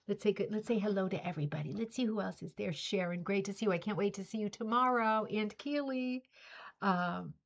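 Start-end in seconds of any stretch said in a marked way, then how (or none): none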